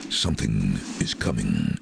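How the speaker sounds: low, portentous movie-trailer voice; trailer voiceover. Acted. Voice artist records Jurassic Park trailer, low and portentous. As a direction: announce it like a blockbuster trailer. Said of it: "the first line was".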